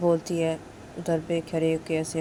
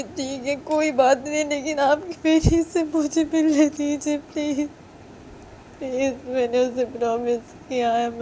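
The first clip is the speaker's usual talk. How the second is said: crying plea; 9 semitones higher, 4 dB louder than usual. Acted. Girl tearfully begging the mistress to see her daughter. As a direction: sob out the plea. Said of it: "रुई ऐसे रोते-रोते बोलती कि".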